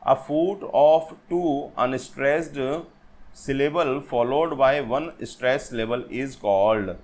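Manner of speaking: very slow, word-by-word definition; deliberate, drawn-out teacher's dictation. Shown as a demonstration. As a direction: read it out slowly for note-taking. Reads out a definition for students.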